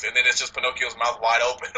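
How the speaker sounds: ordinary talk, nothing marked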